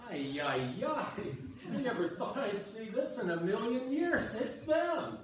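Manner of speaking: high, loud, laughing amazement; can't believe his eyes, bursting with glee; overjoyed shock at the sight; excited disbelief. Acted. Wives unexpectedly appear on ice.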